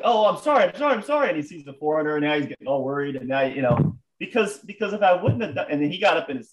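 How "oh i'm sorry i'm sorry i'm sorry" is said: high, panicky mock apology; frantic retold pleading, pitched way up; imitated freaking out. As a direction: imitate a flustered man apologizing. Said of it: "freaking out".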